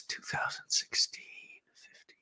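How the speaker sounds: thinking aloud under his breath; whispering to himself. Real, not acted. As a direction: whisper the dates while recalling. Thinking aloud about the year.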